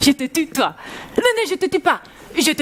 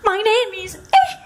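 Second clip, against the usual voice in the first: high mock [name] singing with yelps; [name] impression; 6 semitones higher than usual. Teacher mocks learning English from [name] songs, high. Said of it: "avec [name]".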